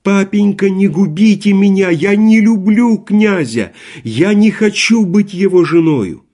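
high, loud tearful begging; desperate pleading. A narrator's reading. Daughter begs her father to spare her, desperate. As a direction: beg him as if your life depends on it.

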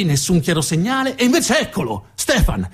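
ordinary delivery

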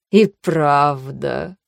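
low, very slow unhinged regret; regret, tinged with madness; mad regret. Acted. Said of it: "Сожаление с лёгким привкусом безумия".

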